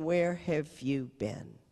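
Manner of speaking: slow, level question; nun recalling his words, slow; plain slow retelling. Retold or imitated. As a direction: let it hang, slowly. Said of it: "he's looking at me and saying".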